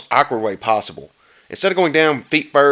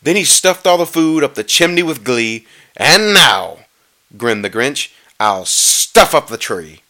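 measured rhyming read with drawn-out key words; storybook narration; 5 dB louder, slower than usual. A narrator's reading. Reader telling how the Grinch stole presents, storybook narration. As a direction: read the verse aloud, lingering on the stresses.

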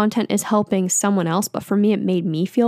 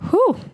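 high, breathy exhale of relief; relieved whew; 14 semitones higher than usual. Real, not acted. After deleting TikTok, a high relieved whew. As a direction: blow it out like a weight just lifted.

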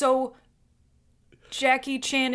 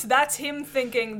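normal talking voice

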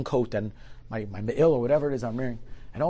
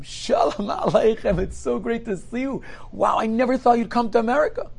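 effusive greeting; 11 semitones higher, 5 dB louder than usual. Acted. Stranger greets him warmly.

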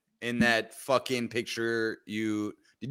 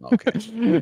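higher, louder put-on okay; mock exclaim; 8 semitones higher, 6 dB louder than usual. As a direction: toss it out in a silly voice. Mock reaction.

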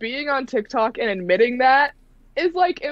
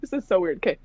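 ordinary delivery

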